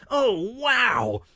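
high, drawn "wow"; stunned exclamation. A narrator's reading. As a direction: let the relief burst out high. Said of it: "I managed to utter".

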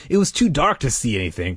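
usual speaking voice

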